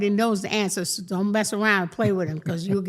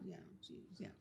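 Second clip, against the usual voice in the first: ordinary talk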